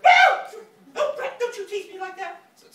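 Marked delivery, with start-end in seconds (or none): 0.0-0.1 high, loud yelp of shock; startled shriek. Retold or imitated. Mother jumping at her son sneaking up behind her, startled shriek. 1.0-2.4 very high, loud mock-mother screech; scolding mother. Mother to the son who sneaked up on her, shrill scolding.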